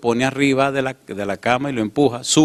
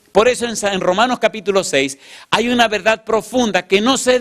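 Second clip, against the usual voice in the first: fervent preaching; 7 semitones higher than usual. Real, not acted. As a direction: build to a passionate climax. Preacher rises to climax. (predicación fervorosa)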